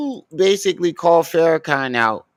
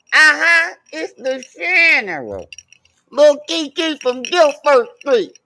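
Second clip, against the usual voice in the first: General Froze impression; 11 semitones higher than usual. Imitating General Froze again.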